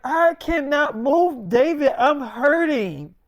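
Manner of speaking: high, loud, drawn-out wail of protest; hammed-up pained complaint; dramatic complaint. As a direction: play the suffering wife, stretching the words slowly. Sick wife complaining she hurts.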